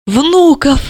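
high, delighted cry; excited. Acted. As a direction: burst out with joy. Overjoyed at grandchildren.

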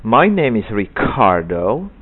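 "Ricardo" slow with popped k; aspirated k. Shown as a demonstration. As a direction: read it slowly, k bursting. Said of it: "dengan pronunciation yang benar".

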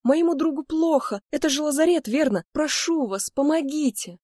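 high-pitched feigned distress; urgent pleading. Pretend. Героиня разыгрывает мольбу о помощи. (притворно отчаянная мольба высоким голосом)

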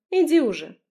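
high, loud dismissive send-off; snorting. A narrator's reading. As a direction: shoo them away loudly. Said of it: "фыркнула Лерка".

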